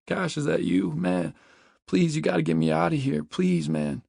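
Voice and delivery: high, pleading cry for help; desperate whimpering. A narrator's reading. Trapped man begs Cash to get him out, whimpering desperately. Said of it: "Matchbox whimpers desperately from inside".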